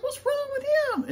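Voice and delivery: very high, slow puzzled teacher voice; mock teacher voice. Dad mimics the teacher in a high falsetto. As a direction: squeak out the teacher's question. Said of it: "Enough with the voices".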